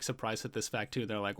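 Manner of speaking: ordinary talk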